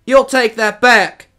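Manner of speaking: put-on high, loud, slowed boyish demand; panting demand. A narrator's reading. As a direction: shout it indignantly, chasing him. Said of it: "panted Joel".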